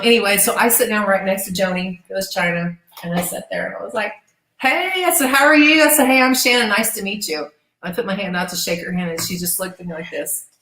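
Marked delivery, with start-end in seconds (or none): 4.6-7.5 high, chirpy retold hello; cheery greeting. Introducing herself to a new colleague, cheery. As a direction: act out a friendly, eager introduction.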